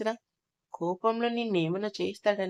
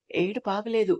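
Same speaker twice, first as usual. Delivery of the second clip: plain reading voice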